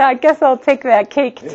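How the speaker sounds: amused offer. Retold or imitated. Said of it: "I said".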